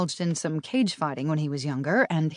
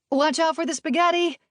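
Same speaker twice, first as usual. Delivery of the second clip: calling out; 11 semitones higher than usual. A narrator's reading. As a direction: shout a warning across the room. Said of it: "someone called".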